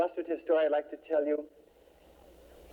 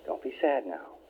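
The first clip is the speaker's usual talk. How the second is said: mother soothing her grown son, lower and tender; gentle mother comforting; 7 semitones lower than usual. Retold or imitated.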